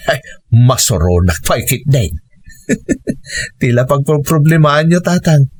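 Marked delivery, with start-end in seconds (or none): 2.7-3.6 short laugh. Acted. Laughs at the old man.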